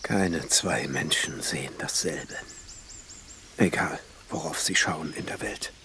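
whisper. Acted. Alchemist's inner musing aside.

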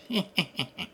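soft high mocking snicker; snickering echo. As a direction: giggle like a cheeky child behind a rock. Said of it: "en ricanant".

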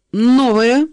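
high, loud rising question; squealing too loudly. A narrator's reading. Banks asking about Sheba's blouse, loud squeal. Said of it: "привзвизгивать на децибел другой громче пристойного".

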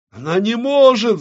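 very high, exultant crowing; gloating. Acted. Авадонна roars at the witch's failure, gloating. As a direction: crow over the failure. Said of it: "захохотала Авадонна".

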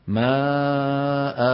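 ماء recited extremely slowly with a long drawn aa; very slow pause form. Shown as a demonstration. Teacher demonstrating the long pause form for the class. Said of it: "انتبهنا؟".

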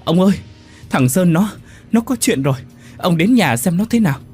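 high, loud urgent plea; wife phoning her husband in fear; frightened wife. Acted. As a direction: urge your husband in a panic. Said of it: "Bà Liên sợ hãi".

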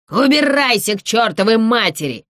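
angry hoarse shout. A narrator's reading. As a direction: shout it roughly through a closed door. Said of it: "крикнул голос". (сердитый хриплый крик)

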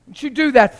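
ordinary talk

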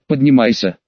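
usual speaking voice

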